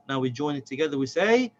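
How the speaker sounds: usual speaking voice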